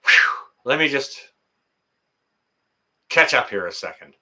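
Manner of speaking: usual speaking voice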